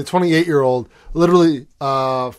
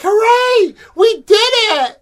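high, slow, hammed-up cheer; mock cheering crowd; 5 dB louder, much slower than usual. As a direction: gush like a cheesy movie crowd. Mocking a movie's ending crowd.

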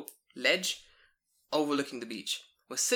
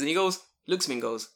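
usual speaking voice

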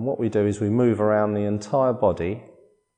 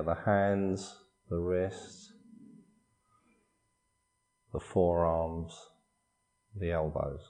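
soft, unhurried body-scan voice with long pauses; guided meditation; 5 dB quieter than usual. Acted. Meditation teacher guiding listeners through a body scan, calm guided meditation. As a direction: calmly guide them through relaxing, phrase by phrase.

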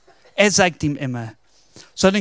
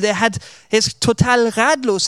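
usual speaking voice

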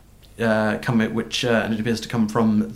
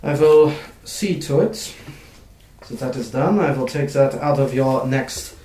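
brisk clerk; 2 semitones higher than usual. Acted. GM voices Carol.

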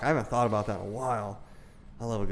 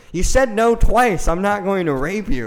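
high, loud, slow incredulous yell; shocked outburst; 10 semitones higher, 10 dB louder, slower than usual. Retold or imitated. Yelling back at a girl in shock. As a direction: blurt it out in disbelief, way up high.

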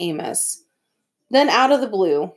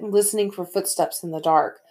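usual speaking voice

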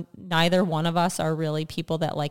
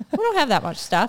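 laughing; 6 semitones higher than usual. Retold or imitated. Laughing retelling of naive claim.

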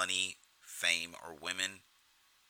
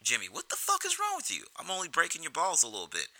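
mock Tommy protesting; 9 semitones higher than usual. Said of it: "Tommy defending his joke".

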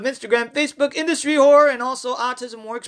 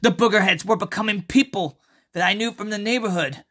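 story narration; 4 semitones lower than usual. A narrator's reading. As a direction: tell the tale unhurried and low. Reads his horror story aloud.

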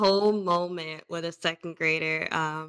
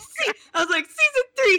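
high, much louder, a bit giggly; excited answer; 12 semitones higher, 4 dB louder than usual. Retold or imitated. Teacher telling the kid her season, high and gleeful. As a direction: blurt the season out with glee.

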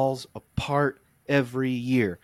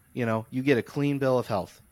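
ordinary talk

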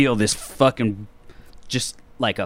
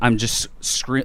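normal talking voice

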